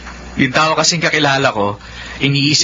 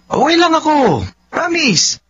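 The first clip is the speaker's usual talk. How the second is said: pleading; 13 semitones higher, slower than usual. Acted. Son begging mother for permission.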